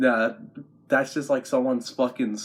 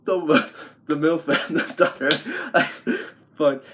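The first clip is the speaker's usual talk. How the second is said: words breaking into laughter; cracking up; 4 semitones higher than usual. Real, not acted. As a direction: struggle to speak through giggles. Cracks up at dream's twist.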